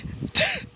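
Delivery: high, squeaky laugh; amused laugh. Real, not acted. Storyteller amused by own silly voice, high laugh. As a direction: burst into a real high giggle.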